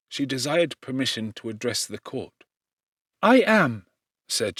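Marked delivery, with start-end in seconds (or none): 3.2-3.9 higher and louder, a sudden agitated cry; agitated outburst. A narrator's reading. Cousin rises in court, agitated and raised. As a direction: burst out in distress. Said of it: "although violently agitated".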